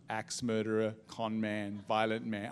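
lightly muttered suspicious labels; cool suspicion. Retold or imitated. Cool gossipers eyeing him, acted out.

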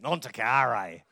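"Non toccare" said low with a broad Aussie accent, laughing; Italian said with a laughing Australian twang. Shown as a demonstration. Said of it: "With an Aussie accent".

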